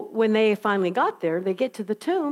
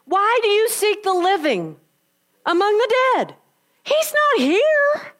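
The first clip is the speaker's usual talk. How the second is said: incredulous angel; 10 semitones higher, 4 dB louder than usual. Acted. Angel asks the women at the tomb, high and amazed. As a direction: voice the angel, amazed anyone came.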